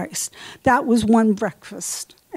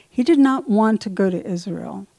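ordinary talk, nothing marked

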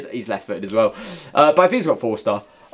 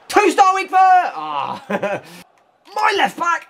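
frustrated shout; 11 semitones higher, slower than usual. Real, not acted. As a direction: yell at the missed shot. Frustrated at missed chance.

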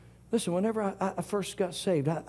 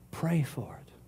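whisper; slower than usual. Acted. The Lord's words, whispered.

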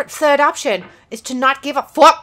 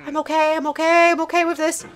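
high, softer repeated reassurance; strained self-soothing; 5 semitones higher, 4 dB quieter, faster than usual. Real, not acted. Gamer talking self down, strained self-soothing. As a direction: chant it high to hold yourself together.

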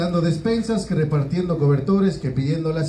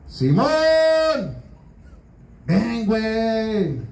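calling out; 3 semitones higher, much slower than usual. Retold or imitated. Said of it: "Le dijo".

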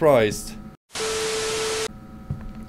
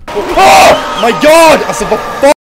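scared horror game streamer; 12 semitones higher, 11 dB louder than usual. Real, not acted. Scared shout.

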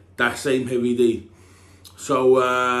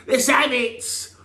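hyped producer; 9 semitones higher, much slower than usual. Retold or imitated. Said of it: "They said".

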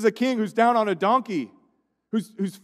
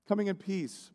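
soft echo; 10 dB quieter than usual. Acted.

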